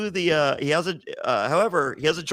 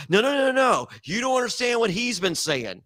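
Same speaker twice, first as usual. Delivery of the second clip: excited insistence; 8 semitones higher than usual. Retold or imitated. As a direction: protest it, all worked up. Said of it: "He's like".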